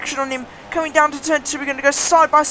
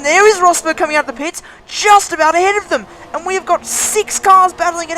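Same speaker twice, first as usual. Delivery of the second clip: excited; 6 semitones higher, 6 dB louder than usual. Real, not acted. Live race, rival exits pits.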